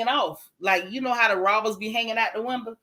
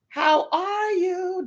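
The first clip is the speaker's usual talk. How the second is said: high, slow, drawn-out hello; cooing hello to a favourite viewer; sing-song greeting; 8 semitones higher, much slower than usual. Real, not acted. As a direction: stretch each word sweetly up high.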